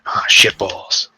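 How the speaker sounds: whispered swear. Retold or imitated. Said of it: "and you just hear".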